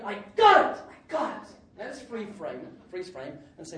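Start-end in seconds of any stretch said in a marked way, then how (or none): 0.0-1.5 loud, high cry of triumph, then easing off; retold joyful scream. Preacher retelling his run down the church pews, exultant scream. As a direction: re-enact screaming the good news. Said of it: "and screamed".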